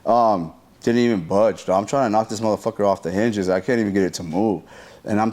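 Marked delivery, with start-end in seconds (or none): none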